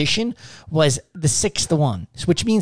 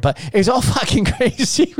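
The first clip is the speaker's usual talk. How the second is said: laughing; 5 semitones higher than usual. Real, not acted. Laughing through the line.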